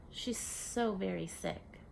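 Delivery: ordinary delivery, nothing marked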